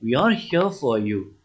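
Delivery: soft, soothing reassurance; comforting. Acted. Friend tells Hari not to cry, comforting. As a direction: gently console a crying friend, softening further.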